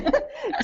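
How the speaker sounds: quick high-pitched giggle; a light laugh at her own joke; short bright giggle. Real, not acted.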